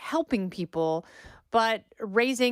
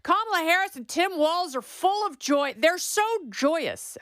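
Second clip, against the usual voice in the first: sarcastic gushing; 8 semitones higher than usual. Retold or imitated. Host mocking a campaign talking point with sugary sarcasm. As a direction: gush sarcastically about their joy. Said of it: "barf-worthy".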